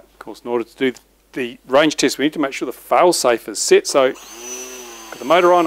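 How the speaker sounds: ordinary delivery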